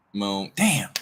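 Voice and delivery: usual speaking voice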